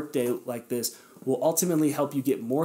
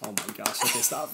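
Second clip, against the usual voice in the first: ordinary delivery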